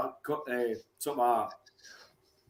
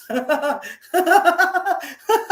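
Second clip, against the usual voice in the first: big laugh; 14 semitones higher, 5 dB louder than usual. Real, not acted. Laughing at own joke.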